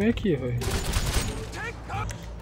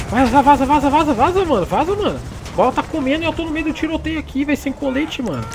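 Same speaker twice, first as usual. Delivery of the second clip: under fire panic; 6 semitones higher than usual. Real, not acted. Caught in a firefight, fleeing.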